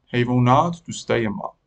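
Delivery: ordinary delivery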